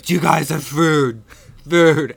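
loud, pleading American tourist; hammed-up pleading for food; desperate asking. Begging for food at pubs.

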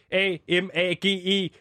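letter by letter; spelled out. Shown as a demonstration. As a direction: spell out each letter slowly. (stavet bogstav for bogstav)

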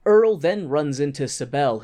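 ordinary talk, nothing marked

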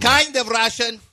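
high mock Russian accent; Darko. Coach correcting where he is from, high Russian accent. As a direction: insist in a put-on Slavic accent. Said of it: "Your Darko impression is".

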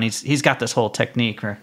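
usual speaking voice

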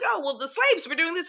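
high, dismissive mock quote. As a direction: parrot the lazy phrasing. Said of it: "It's like".

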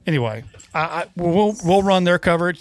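ordinary talk

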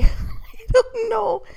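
laughing. Real, not acted. Laughs at looking crazy.

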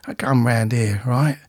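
lightly voiced Cockney hard man; Jason Statham. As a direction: do a bit of gruff London tough guy. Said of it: "Not like Jason Statham, though, innit?".

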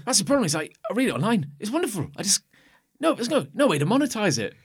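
high, loud, earnest punter; imitated eager fan. Imitating fan lamenting no payment.

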